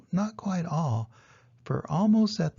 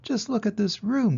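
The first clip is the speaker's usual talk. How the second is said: higher, dismayed outcry; cried out; 7 semitones higher than usual. Acted. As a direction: exclaim at the mess. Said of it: "cried Farmer Dilly".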